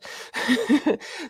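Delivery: high, light laugh; self-mocking laugh. Real, not acted. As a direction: laugh at your own tongue-tied moment. Laughs at own trouble saying words.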